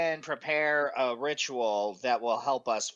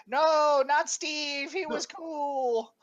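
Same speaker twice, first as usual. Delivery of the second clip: high pleading mock protest; high whiny voice; 10 semitones higher, 4 dB louder, much slower than usual. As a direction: whine it high and loud. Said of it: "you're gonna be like".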